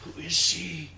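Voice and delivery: hushed, unvoiced disbelief; whispered disgust. Chat voiced as disgusted characters, whispering.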